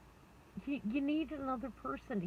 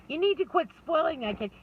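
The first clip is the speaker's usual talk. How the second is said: louder, higher mock scolding of a dad; stern dad scolding; 8 semitones higher, 9 dB louder than usual. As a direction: lay down the law like a jealous father. Jealous dad scolds the mother.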